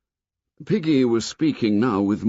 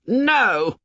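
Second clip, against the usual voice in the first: high, loud furious scream; high-pitched enraged cry; 5 dB louder than usual. A narrator's reading. Jack crying out at Ralph, high-pitched and enraged. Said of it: "high-pitched, enraged".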